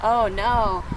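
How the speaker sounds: ordinary delivery, nothing marked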